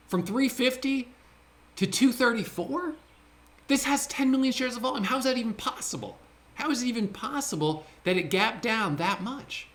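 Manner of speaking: incredulous cry of disbelief at the chart; bewildered disbelief. Real, not acted. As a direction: voice jumps way up in stunned outrage. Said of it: "I'm bewildered. I'm just genuinely shocked".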